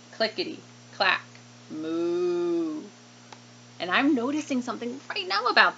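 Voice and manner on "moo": long lowing moo; storybook cow moo. Acted. Cows mooing in picture book.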